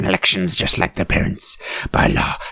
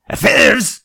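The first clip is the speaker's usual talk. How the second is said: "Affairs?" said high and loud with a rising tune; a mock-shocked "Affairs?"; scandalized affairs; 10 semitones higher, 4 dB louder than usual. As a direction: pounce on "Affairs?" as if it were a scandal.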